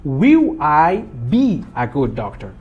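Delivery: slow, spaced-out model sentence; teacher spelling out the word order slowly. Shown as a demonstration. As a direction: lay out WILL, I, BE one deliberate word at a time. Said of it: "E faço a entonação".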